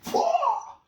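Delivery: loud high imitated arrow sound; arrow whoosh. Narrator voicing the arrow shot, loud high whoosh. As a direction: make the twang of a released arrow.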